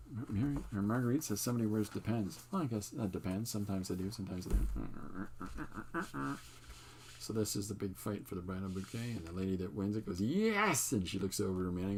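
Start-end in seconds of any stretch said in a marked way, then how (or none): 4.1-6.4 slow punchline. Acted. Punchline of a pun. 10.2-10.9 a much higher, louder yelp of victory; triumphant cheer. Acted. Bride triumphs catching bouquet.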